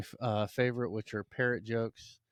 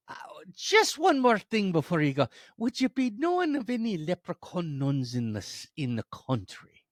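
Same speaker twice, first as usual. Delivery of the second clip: high, loud, lilting leprechaun; politely persistent leprechaun voice; 9 semitones higher, 7 dB louder than usual. Acted. Leprechaun pressing Mother Superior further, high eager lilt.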